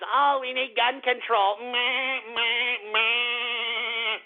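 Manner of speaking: slow mock quote of the senator, then bleating meh, meh; mocking whine. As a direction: sneer at his plea, then bleat it like a goat. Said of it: "filibustering because".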